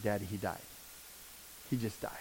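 barely audible, hushed grief; broken news. Retold or imitated. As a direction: let the words collapse as you tell your father. Telling his dad the son died.

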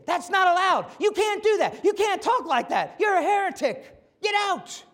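imitating the high priest yelling at Jesus; shrieking high priest driving him out; outraged high priest. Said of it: "you're gonna say".

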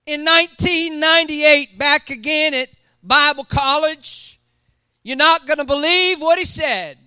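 loud, high, rising sermon delivery; full-force preaching. Acted. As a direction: preach it big to the congregation.

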